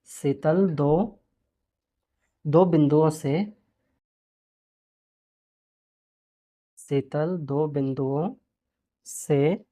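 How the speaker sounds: slow, word by word dictation for writing. Shown as a demonstration. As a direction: dictate slowly so pupils can copy. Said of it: "teacher dictating while writing".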